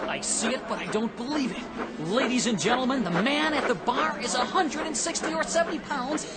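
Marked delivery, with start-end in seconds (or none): 2.1-6.3 high, rising, amazed broadcast call; excited announcer. Acted. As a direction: sell the miracle to the audience. Reporter announces the amazing feat live.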